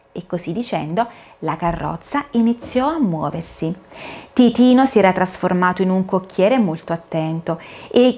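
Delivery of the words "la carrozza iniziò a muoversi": ordinary delivery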